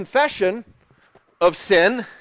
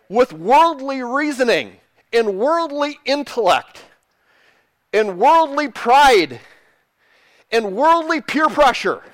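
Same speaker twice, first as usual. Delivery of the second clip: raised pitch, drawn-out emphatic list; forceful preaching; 5 semitones higher, 4 dB louder than usual. Real, not acted. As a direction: hammer each item like a preacher.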